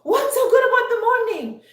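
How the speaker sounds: high, loud, angry outburst at the cheerful coworker; went off. Retold or imitated. Grumpy coworker yells at the always-happy colleague, angry shout. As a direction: snap at her like a man in a foul mood. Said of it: "And he just went off".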